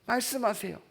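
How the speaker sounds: ordinary talk, nothing marked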